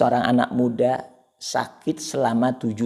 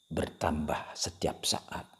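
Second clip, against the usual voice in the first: 1 whisper; 5 semitones lower, 8 dB quieter, slower than usual. Real, not acted. Whispered ending.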